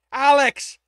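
loud high desperate shout. A narrator's reading. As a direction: yell his name with all you have. Desperate call for missing Alex.

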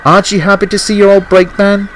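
high, cheery and eager; gaily. A narrator's reading. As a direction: ask it brightly, pitched well up. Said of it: "Arthur said gaily".

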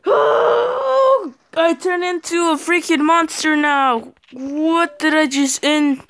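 high, loud cry of disbelief; shocked outburst. Real, not acted. Gamer just killed asking how he died, shocked high shout. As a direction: wail loudly at a sudden death. Dies suddenly in the game.

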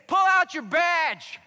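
high, loud and slow call across the field; shouted taunt. Retold or imitated. Farmer calling to the chased agent, loud, slow taunt. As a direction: yell it back with mocking glee. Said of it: "He said".

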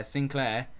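normal talking voice

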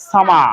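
সামা with the final r dropped; British pronunciation. Shown as a demonstration. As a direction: end সামা on an open vowel, British style. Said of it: "তারা এই সামার শব্দটাকে বলবে".

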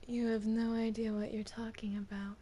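low breathy whisper; whispery voice. Acted. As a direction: whisper it in a strange possessed voice. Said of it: "She even sounds weird now".

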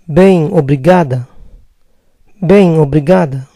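bem, obrigada with nasal bem; Brazilian bem obrigada. Shown as a demonstration. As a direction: answer as a Brazilian woman. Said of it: "Si lo dice una mujer".

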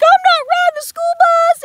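put-on high, loud whiny baby voice; crying child voice. Retelling refusing the school bus as a crying child, high whine. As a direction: wail it like a scared little kid. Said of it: "You, you heard that baby voice".

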